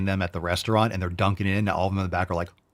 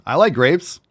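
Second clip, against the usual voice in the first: higher, louder and upbeat; lively approval; 6 semitones higher, 7 dB louder, slower than usual. Real, not acted. Streamer spotting a bowl of grapes, lively approval. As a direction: perk up with pitch lifted on LIKE. Same words, lively first take.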